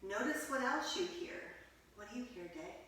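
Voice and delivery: soft, hushed meditation voice; guided meditation. Acted. Teacher guiding children into quiet listening, hushed. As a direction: settle the children into stillness.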